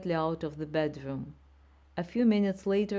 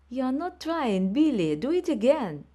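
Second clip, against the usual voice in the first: high, loud, impatient command; shouting teacher; 8 semitones higher, 5 dB louder than usual. A narrator's reading. Ballet teacher shouting at Billy in class, impatient shout. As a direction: drive the pupil hard. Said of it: "Miss was shouting at me".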